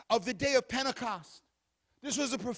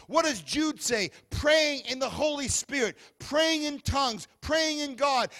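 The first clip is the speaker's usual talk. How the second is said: higher and louder, building to shouted praise; preaching at full force; 3 semitones higher, 6 dB louder than usual. Acted. Preacher stirs the church about praying in tongues, shouting. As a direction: rouse the congregation, pounding every line.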